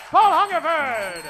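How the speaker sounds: normal talking voice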